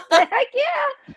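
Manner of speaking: laughing high and loud. Real, not acted. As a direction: laugh through it. Laughing reply.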